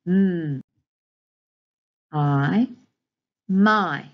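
each sound said loud and separate, then blended into my; sounding out phonics. Shown as a demonstration. Teacher to class modelling segmenting, loud separate sounds. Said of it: "sound out each of these graphemes, then blend them".

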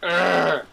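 loud exasperated "urgh"; frustrated growl. Real, not acted. Gamer blocked by a player, loud frustrated groan.

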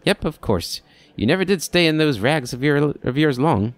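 put-on high, jolly old-timer voice; chatty old man. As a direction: play a doddering old keeper delighted to see him.

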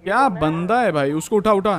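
real shock at the knockdown; excited outburst. Real, not acted. Reacts to teammate going down.

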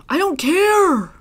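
high, loud, slow sing-song; dramatic. Pretend. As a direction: toss it off theatrically. Dramatic dismissal.

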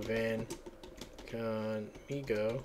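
drawn-out, slow, syllable by syllable; slow dictation. Shown as a demonstration. Typing the Spanish phrase for the program, said slowly. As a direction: say it slowly as you type it in.

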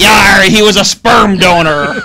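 loud, slow shouted pirate voice. Pretend. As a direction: bellow it like a pirate captain. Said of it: "Talk Like a Pirate Day".

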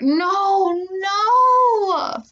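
loud, high, drawn-out cry; horrified wail. A narrator's reading. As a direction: cry out in utter dismay. Horror at finding shredded forms.

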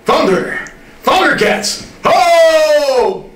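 rising to a high, loud shout; hero's rallying cry for the boys; Thundercats battle cry. Retold or imitated. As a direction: build the cry up to a big ho. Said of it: "he's the one who says".

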